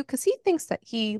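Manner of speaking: normal talking voice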